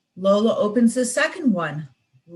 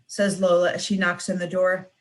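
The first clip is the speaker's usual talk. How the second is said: ordinary delivery, nothing marked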